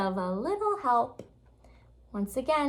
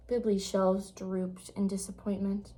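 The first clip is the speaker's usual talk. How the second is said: plain reading voice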